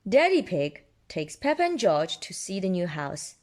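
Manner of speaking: plain reading voice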